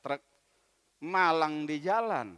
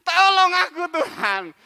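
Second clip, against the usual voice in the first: pleading loudly to God; frantic shouted plea stuck in traffic; desperate loud prayer; 6 semitones higher, 11 dB louder than usual. Acted. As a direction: beg God for help at full voice. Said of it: "Dia berdoa kepada Tuhan".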